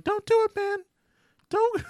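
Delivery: very high-pitched dummy voice; Valentino. Retold or imitated. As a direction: squeal it out in a tiny, frantic dummy voice. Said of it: "He has a very high-pitched voice".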